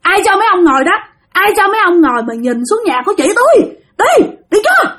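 high, scolding shout; angry chasing off. Retold or imitated. Dreamer scolding ghost soldiers off the roof, high and angry. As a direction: drive the soldiers away with a high yell. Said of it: "Thi mới chửi".

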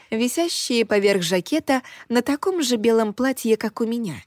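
ordinary delivery, nothing marked

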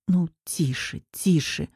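soft, gentle shushing; soothing hush. A narrator's reading. Rescuer rocking the crying woman like a child, soothing hush. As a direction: hush her tenderly, softly.

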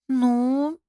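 long, high, hesitant drawl; drawled, noncommittal hedge; drawn out. A narrator's reading. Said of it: "протянула я".

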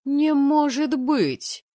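loud, high, drawn-out disbelief; stunned high exclamation; shocked. A narrator's reading. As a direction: cry out in stunned amazement. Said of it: "потрясённо выдохнули парни".

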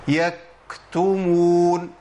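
يكتمون drawn out very slowly, the k shut off with no breath; wrong stopped k. Shown as a demonstration. Teacher showing a common recitation mistake. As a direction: hold the k in يكتمون closed, no air escaping. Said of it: "ده خطأ".

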